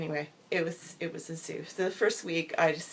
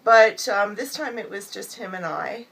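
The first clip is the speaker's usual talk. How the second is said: ordinary talk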